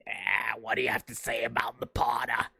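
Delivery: raspy whispered Palpatine voice; whispered Sith lord voice; Palpatine impression. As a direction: hiss it like an evil emperor. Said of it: "doing a Snape and Darth Vader voice".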